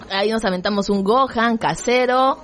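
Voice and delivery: normal talking voice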